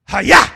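"Hayah" shouted loud, rising at the end; shouted Hebrew word. Shown as a demonstration. Preacher showing the congregation how to say "Hayah". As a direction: bark out "Hayah" with full force. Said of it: "you gotta say it like that".

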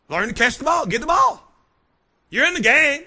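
high, loud sideline yell; coach shouting. Acted. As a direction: bark it like a coach. Coach yelling at fielder.

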